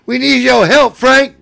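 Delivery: high, loud mock plea; imitated screaming. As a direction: holler for Frank's help, hammed-up. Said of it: "he starts screaming about Frank".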